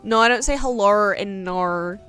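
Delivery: usual speaking voice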